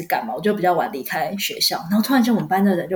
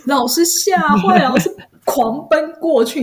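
higher, slow and emphatic; dramatic retelling; 7 semitones higher, 4 dB louder, slower than usual. Real, not acted. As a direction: play up the panic. (夸张地讲述惊吓)

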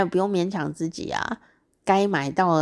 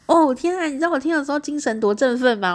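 excited burst, high and loud; 8 semitones higher, 5 dB louder than usual. Retold or imitated. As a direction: exclaim it as if suddenly jolted wide awake. (一下子振奋起来，又高又响)

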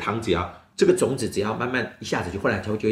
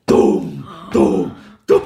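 low, slow whispered thumps; knocking sound; 9 semitones lower, 8 dB louder, much slower than usual. Acted. Sound effect in story.

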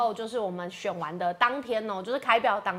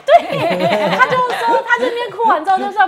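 loud, higher, bursting with laughter; laughing while telling; 13 semitones higher, 12 dB louder than usual. Real, not acted. Son laughing about his dad rushing the school transfer, laughing loudly. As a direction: laugh your way through the memory. Laughing recalling father's reaction.

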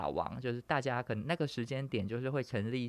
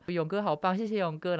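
higher-pitched mock fan gushing; grateful fans; 6 semitones higher, 3 dB louder than usual. Players thanking their top player in chat, mock gushing. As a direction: thank your hero like an adoring fan. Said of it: "然后里面就会说".

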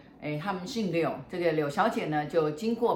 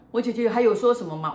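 cutting in eagerly, higher and louder; eager interruption; 5 semitones higher, 6 dB louder than usual. Retold or imitated. A grieving sister cutting in for more of her sister's message, eager and louder. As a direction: jump in, hungry for more news. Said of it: "突然打断的话说".

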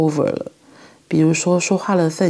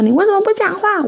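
much higher and louder, an imitated angry demand; angry child; 5 dB louder, faster than usual. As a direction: play the furious child snapping at mom, much higher. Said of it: "他就很生气说".

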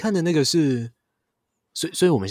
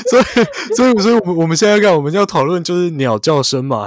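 laughing while talking; 7 semitones higher, 7 dB louder, faster than usual. Real, not acted. Still laughing, high and loud.

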